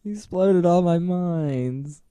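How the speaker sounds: high, slow imitation of a wronged Aussie; hammed-up Australian whine; mock lament. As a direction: stretch it out in mock dismay. Imitating the Australian character's complaint.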